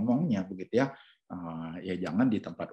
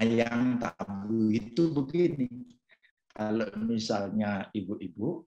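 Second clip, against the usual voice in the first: ordinary talk, nothing marked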